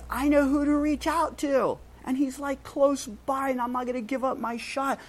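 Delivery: high, eager blind beggar; determined beggar. Retold or imitated. Bartimaeus resolving to reach Jesus, high eager voice. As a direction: pump yourself up like a desperate man.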